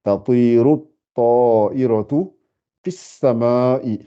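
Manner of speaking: "tatiru at-ta'iratu fis sama'i" slowly, the words joined; slow careful reading. Shown as a demonstration. Teacher modelling the full sentence for students, slow. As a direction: articulate every syllable of "fis sama'i", slowly. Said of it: "Donc, faut bien dire".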